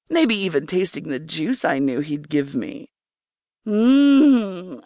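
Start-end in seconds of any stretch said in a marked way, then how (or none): 3.7-4.8 long, louder hummed "mm"; drawn-out hum of pleasure; pleasured sigh. A narrator's reading. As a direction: sigh contentedly through closed lips. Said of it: "I sighed".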